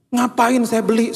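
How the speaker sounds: high, drawn-out objection; indignant protest. Retold or imitated. Dad refusing organizer's plea to buy, high indignant protest.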